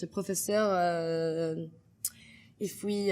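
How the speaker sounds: hesitant French accent with a long euh; French-accented, pausing to find the word. Shown as a demonstration. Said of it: "very prominent in French language".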